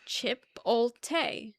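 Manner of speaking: Chipotle said slowly as chi-POLD-tay with hard T; spelled-out pronunciation. Shown as a demonstration. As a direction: sound out Chipotle slowly, letter by letter. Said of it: "Say how it's spelled".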